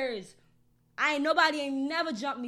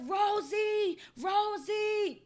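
screaming for her; 8 semitones higher, much slower than usual. Retold or imitated. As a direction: scream the name to be heard over the fight. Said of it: "until I started screaming".

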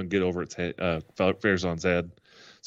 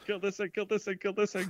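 high frantic squeal, softer; panicked squeal; 11 semitones higher, 4 dB quieter, faster than usual. Retold or imitated. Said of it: "laughs then voices Cal".

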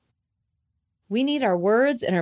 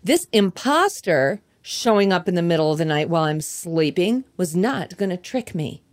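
usual speaking voice

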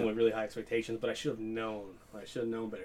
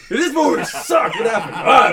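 laughing; 11 semitones higher, 11 dB louder, slower than usual. Real, not acted. Laughing while talking.

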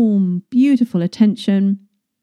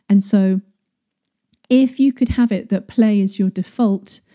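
usual speaking voice

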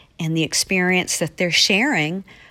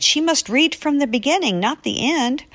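put-on irritated child; 8 semitones higher than usual. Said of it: "he was irritated and said".

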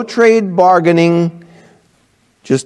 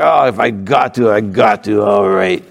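lower, dragging reluctant voice; grudging kid; 7 semitones lower than usual. Retold or imitated. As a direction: sigh it out like a defeated kid. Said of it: "you're gonna do it with a broken spirit".

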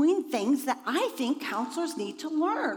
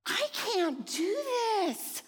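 whiny; 7 semitones higher than usual. Retold or imitated. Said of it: "and I'm just like".

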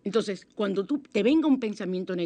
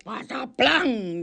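mock crash; 4 semitones higher, 3 dB louder, much slower than usual. Imita el ruido de la escoba.

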